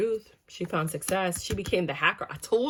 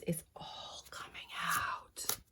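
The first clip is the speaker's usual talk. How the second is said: whispered reveal; 12 dB quieter, much slower than usual. Acted. Dramatic card reveal.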